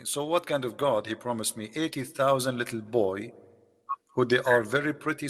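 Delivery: ordinary talk, nothing marked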